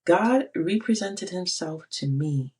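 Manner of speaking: ordinary delivery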